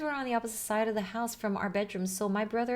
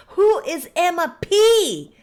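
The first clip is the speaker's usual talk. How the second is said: frantic; 10 semitones higher, 14 dB louder, slower than usual. Acted. Panicked dad wondering about daughter.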